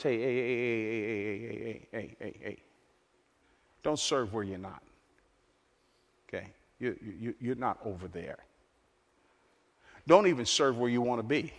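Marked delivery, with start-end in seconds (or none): 0.0-8.4 a lightly voiced, soft, low Jesus hushing his disciples; Jesus gently checking them. Jesus quieting the ambitious disciples, lightly voiced soft check. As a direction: settle them down with quiet repeated heys. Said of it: "He says in so many words".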